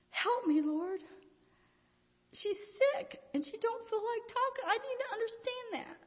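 high-pitched earnest plea; acted pleading prayer. Woman asking the Lord's help over her sister, pleading. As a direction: pray it high and held, as if pleading.

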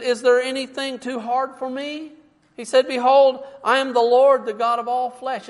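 loud, rising preacher's cry; full-force pulpit proclamation; preaching. Acted. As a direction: call it out to the whole church.

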